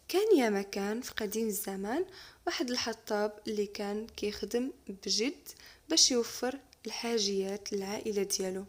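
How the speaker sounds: usual speaking voice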